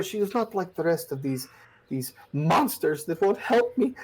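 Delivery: a hint of sobbing mother praising her girl; tearful mother. Mother describing her daughter.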